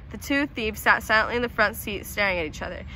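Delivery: ordinary delivery, nothing marked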